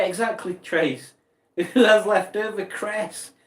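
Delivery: ordinary talk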